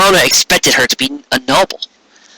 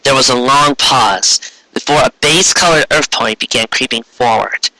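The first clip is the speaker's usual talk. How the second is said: ordinary delivery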